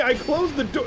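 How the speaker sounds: high, loud victorious cry; triumphant shout. Real, not acted. Gamer celebrating the closed door, triumphant shout. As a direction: crow that you've shut them out.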